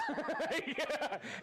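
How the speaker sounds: high, bubbling laugh. Real, not acted. Host thanking viewers in their offices, breaking into laughter.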